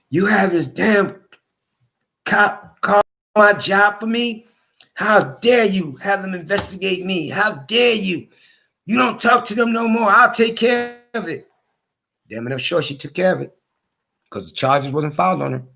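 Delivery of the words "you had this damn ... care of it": mock the wife's furious demands; retold angry wife. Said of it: "Then she tells me".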